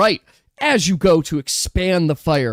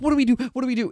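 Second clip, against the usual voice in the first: mock servant panicking, higher; panicked; 8 semitones higher, much faster than usual. Servant runs in, panicking at the fire. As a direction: flap about in a panic. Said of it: "they go, like".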